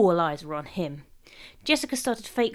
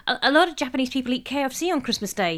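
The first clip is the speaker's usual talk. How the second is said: mildly desperate, higher and stammered; 5 semitones higher, 4 dB louder, faster than usual. A narrator's reading. Derek trying to save the situation, desperately. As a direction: stumble in, trying to change the subject. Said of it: "he said desperately".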